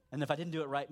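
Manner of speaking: normal talking voice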